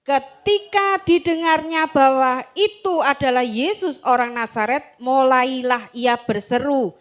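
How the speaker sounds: slow, solemn Bible reading; measured sermon reading voice; scripture reading. Acted. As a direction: read the Gospel to the congregation. Bible passage read aloud.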